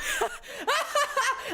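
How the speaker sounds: very high-pitched burst of laughter; helpless high cackle; high squealing laugh. Real, not acted. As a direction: crack up in a squeal. Cracking up at the coincidence.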